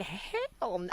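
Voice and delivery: hushed half-said hell no; quiet cut-off. Retold or imitated. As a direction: breaks off the word under breath. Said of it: "I didn't say".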